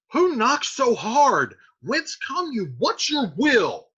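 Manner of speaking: slow, high, loud demand; shouting at door. Acted. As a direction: call out each question toward the door. Friar shouts at insistent knocker.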